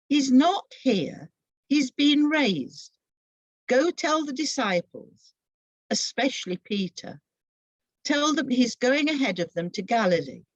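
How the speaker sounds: joyful announcement. Acted. Angel telling the women he is risen, proclaiming. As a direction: proclaim the news with awe.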